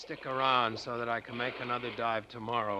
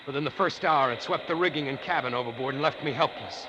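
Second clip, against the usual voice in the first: louder and louder, voice rising as the waves hit; shouting over storm; 6 semitones higher, 6 dB louder than usual. Acted. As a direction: raise your voice as if the hurricane is roaring around you. Letter describes hurricane.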